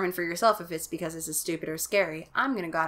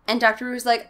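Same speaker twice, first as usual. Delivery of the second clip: normal talking voice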